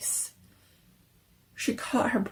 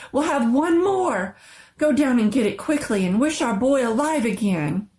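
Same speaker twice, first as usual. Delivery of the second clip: triumphant; 5 semitones higher, 6 dB louder than usual. A narrator's reading. Wife ordering her husband to fetch the paw, triumphant. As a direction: urge him on in triumph. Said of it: "she cried triumphantly".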